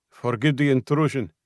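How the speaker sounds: put-on thick Russian accent, apologetic; apologetic guard, thick Russian accent. A narrator's reading. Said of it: "in a thick Russian accent".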